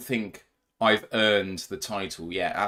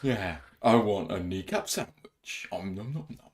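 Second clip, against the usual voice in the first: hungry monster; 6 dB quieter than usual. Pretend. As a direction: savour it like a beast at dinner. Joking about eating kneecaps.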